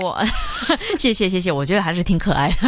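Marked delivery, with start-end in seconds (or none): 0.3-0.9 a bashful little laugh; giggle. Real, not acted. Host called cute by the teacher, a bashful high giggle.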